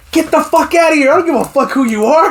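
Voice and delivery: high-pitched mock yell; imitated yelling cop. Cop ordering a man away, mock yelling. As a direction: yell like a cop shooing someone off. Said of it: "the cop was yelling at him".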